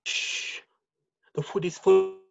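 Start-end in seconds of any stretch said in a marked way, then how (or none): 0.0-0.6 hushing shh. Acted. Fleeing through the spy-filled wood.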